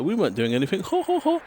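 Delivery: a caught-out excuse, then a high ho ho ho; lightly imitating a caught mayor; mock posh laugh. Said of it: "they jump up and they start laughing".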